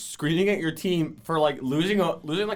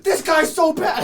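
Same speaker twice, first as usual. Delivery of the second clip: much higher and louder, slow, exasperated complaint; fed-up friend; 13 semitones higher, 9 dB louder, slower than usual. Retold or imitated. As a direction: whine it out like a teammate who's had enough.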